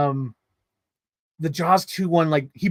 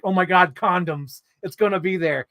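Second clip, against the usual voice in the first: ordinary talk, nothing marked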